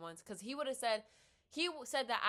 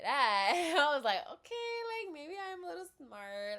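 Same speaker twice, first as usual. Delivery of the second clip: much higher, laughing and giggly; flattered laughing; 8 semitones higher, 8 dB louder than usual. Retold or imitated. Laughing high to the advisor who praised her.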